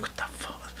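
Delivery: near-silent, whispered disbelief; stunned whisper. Retold or imitated. Dog owner to himself, finding the poison, stunned whisper. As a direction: whisper it under your breath, barely there.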